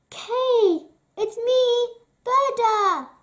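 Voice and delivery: high, loud eager call; excited calling out. Acted. Gerda calling to Kay, excited and loud.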